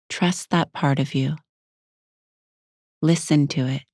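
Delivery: unhurried meditation guide with long pauses; guided meditation. Acted. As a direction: ease the listener inward, leaving space between phrases.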